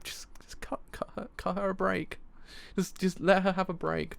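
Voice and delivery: mock plea, starting in a whisper; pleading. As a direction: plead softly for mercy, half whispering. Pleading on her behalf.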